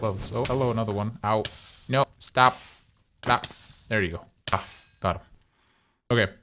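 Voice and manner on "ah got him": usual speaking voice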